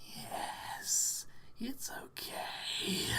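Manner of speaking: slow, creepy whisper; creepiest voice. Shown as a demonstration. Streamer doing a creepy voice on request, slow whisper. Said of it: "Do your creepiest voice".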